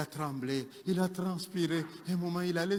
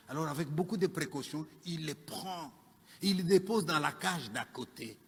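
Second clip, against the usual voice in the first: softer, careful storytelling; hushed, careful; 3 semitones lower, 7 dB quieter than usual. Acted. Keeper gently moves the sleeping man, hushed and careful. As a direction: lower the volume, as if not to disturb. Tiptoeing story moment before punchline.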